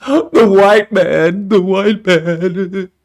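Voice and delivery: slow, dragged-out mocking voice. Mocking what others say.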